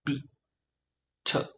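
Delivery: usual speaking voice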